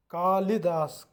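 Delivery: कालिदास drawn out slowly, का-लि-दास; teacher dictating कालिदास; slow careful demo. Shown as a demonstration. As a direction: announce कालिदास slowly and clearly. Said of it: "कालिदास ये हमारा शुद्ध रूप है".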